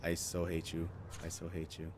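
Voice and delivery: very soft, grumbling under the breath; muttered hatred. Real, not acted. Player grumbling at Max under their breath. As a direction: mumble it bitterly to yourself.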